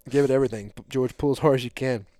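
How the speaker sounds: rally the helper to pull with everything; retold loud orders. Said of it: "I was like".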